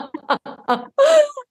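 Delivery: a high laugh through tears; emotional giggle; high teary laugh. Real, not acted. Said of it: "You got me crying".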